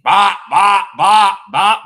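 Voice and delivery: loud high bop beat; vocal beat. Real, not acted. Mimicking the dance beat, loud vocal bops. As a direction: punch each bop high.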